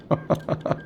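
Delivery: quiet wheezy laugh; wheezing laugh. Real, not acted. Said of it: "I'm wheezing".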